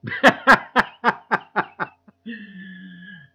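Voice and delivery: loud, high burst of real laughter; big real laugh. Real, not acted. Cracking up at the new North Jersey name, loud real laugh. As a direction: let a genuine laugh break out.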